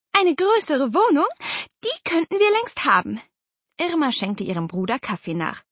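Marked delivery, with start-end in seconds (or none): 0.1-3.2 high, pointed complaint; reproachful. A narrator's reading. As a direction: reproach your brother sharply. Aunt reproaches her brother. (vorwurfsvoll, mit hoher Stimme)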